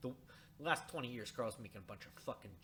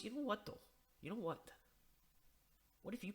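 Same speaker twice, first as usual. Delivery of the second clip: put-on coaxing old painter; 9 semitones higher, 6 dB quieter, faster than usual. Voicing Carl's coaxing line.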